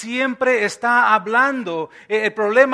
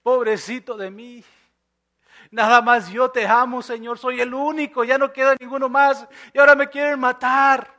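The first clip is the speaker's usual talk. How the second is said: hammed-up self-pitying lament, building louder and higher; mock complaining; 3 semitones higher, 4 dB louder than usual. Preacher voicing Elías complaining to God, hammed-up whining. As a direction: imitate a whiner piling up grievances. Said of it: "se empieza a quejar".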